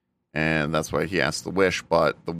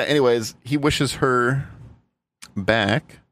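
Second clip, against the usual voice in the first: usual speaking voice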